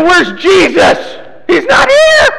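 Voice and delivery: high mock shout of alarm; panicked search. Crowd waking up to find Jesus gone, panicked shout. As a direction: cry it out high, as if someone's gone missing.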